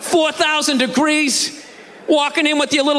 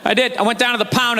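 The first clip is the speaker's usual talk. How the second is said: usual speaking voice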